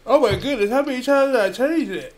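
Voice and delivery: high, loud oh-my-goodness outburst; exasperated exclaim. Real, not acted. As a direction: cry out in disbelief at yourself. Streamer exasperated at own mistake.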